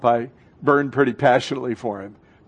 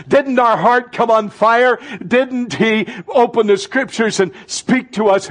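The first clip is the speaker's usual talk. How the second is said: fervent preaching; 6 semitones higher than usual. Real, not acted. Pastor stirring the congregation, fervent and rising. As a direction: let it burn as you say it.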